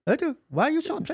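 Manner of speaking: high put-on Indian English accent; mock Indian accent. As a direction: lay on an Indian accent, joking. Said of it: "have you ever worked with Indians".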